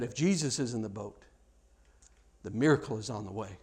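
low, soft, settled voice; quiet assurance. Retold or imitated. Old missionary reassuring the young preacher, quietly. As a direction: drop right down and say it gently.

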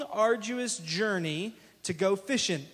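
ordinary talk

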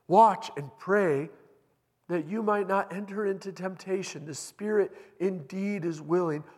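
loud, high, slow urgent appeal; Jesus loudly urges sleeping disciples to watch; urgent rebuke. Acted. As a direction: wake them with a loud, slow plea.

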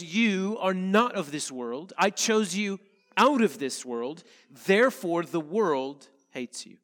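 usual speaking voice

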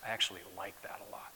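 soft whispered aside. Real, not acted. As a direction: confide it in a whisper.

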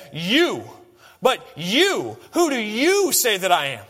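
"you" hit hard each time, slow on "who do you say that I am"; emphatic you. Retold or imitated. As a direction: make "you" the point of the question. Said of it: "And this is emphatic".

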